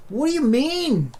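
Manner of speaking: high, loud protest; exasperated yell. Real, not acted. Player protesting to the game that they are trying, exasperated yell. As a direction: raise it into a drawn-out, exasperated cry.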